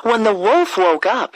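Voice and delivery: ordinary delivery, nothing marked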